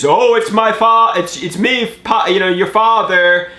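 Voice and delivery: lightly voiced bumbling dad; dad's voicemail. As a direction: imitate a flustered father. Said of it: "the next message is".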